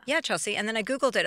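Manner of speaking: ordinary delivery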